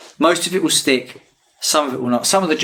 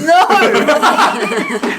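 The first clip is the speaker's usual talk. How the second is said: high loud whine of no; whiny refusal; 6 dB louder than usual. Retold or imitated. The villain refusing to keep fighting, whining. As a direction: yelp it like a hurt child. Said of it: "He's like".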